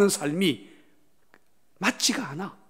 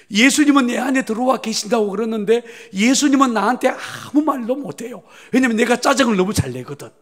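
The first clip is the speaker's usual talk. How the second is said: higher and louder, speeding up at the end; heated preaching; 6 semitones higher, 6 dB louder than usual. Real, not acted. Pastor admits to the church, heated fast preaching. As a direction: pile it on, exasperated.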